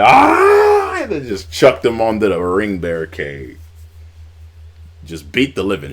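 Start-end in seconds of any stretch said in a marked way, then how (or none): 0.0-1.0 sudden high, loud mock war cry; imitated yell. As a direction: burst out with a wrestler's yell. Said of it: "he was like".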